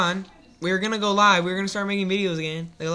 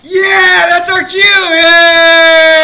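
high, very loud whoop; excited cheering; 9 semitones higher, 13 dB louder, much slower than usual. Acted. Characters hear their cue to appear, wild cheering. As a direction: shout it like a gleeful cartoon character.

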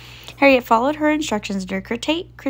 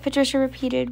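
ordinary delivery

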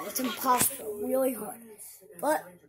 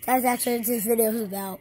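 usual speaking voice

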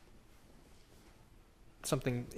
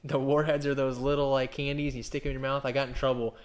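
usual speaking voice

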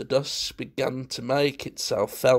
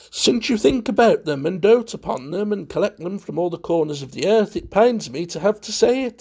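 high, quick, louder society talker; relishing every word; 6 semitones higher, 5 dB louder, faster than usual. A narrator's reading. Valentine needling his host about the collection, with relish. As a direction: rattle it off with showy relish. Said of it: "said Valentine with relish".

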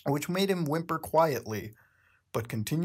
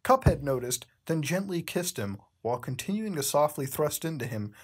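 ordinary delivery

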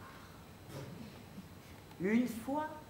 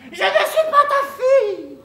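shrieked; 16 dB louder than usual. Acted. Absurd fable climax, shrieked line.

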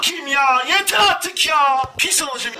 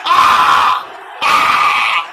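shout; 6 dB louder than usual. Real, not acted. Missed chance, shouting.

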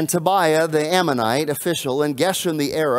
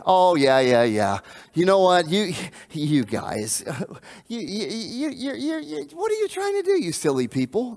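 laughing scorn, climbing higher toward the end; mocking; 4 semitones higher than usual. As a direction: jeer at the builders with a sneering laugh. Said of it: "They mocked and they ridiculed".

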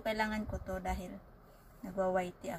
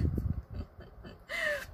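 high squealing laugh; 4 dB quieter than usual. Real, not acted. She bursts out laughing during prank.

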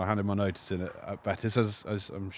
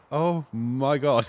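slow, higher, drawn-out realisation; dawning horror; 5 semitones higher, 4 dB louder, much slower than usual. Retold or imitated. Host realising he's led the convoy astray, horrified. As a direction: let the retold mistake sink in slowly. Retold sudden realisation of wrong turn.

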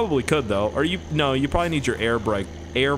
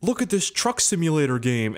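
mock clickbait posters; 4 semitones higher than usual. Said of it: "and they're like".

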